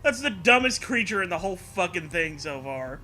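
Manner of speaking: ordinary delivery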